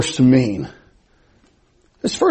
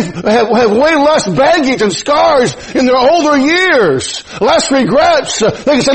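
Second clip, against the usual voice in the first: full-force preaching; 7 semitones higher, slower than usual. Acted. Pastor urges his flock to put God first, preaching hard and high.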